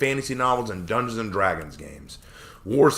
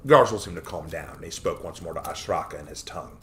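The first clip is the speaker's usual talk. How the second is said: plain reading voice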